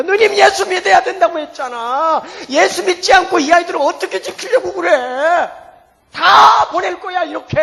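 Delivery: loud, high-pitched reproach; exasperated telling-off, building to a shout; retold scolding. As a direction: scold an older sister with rising force. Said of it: "그러면서 야단을 쳤어요".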